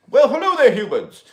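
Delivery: a high, loud, hammed-up alien hello; alien greeting. Alien Ezekiel greets his livestream viewers, high and hammed-up. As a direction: greet the humans like a visitor from space.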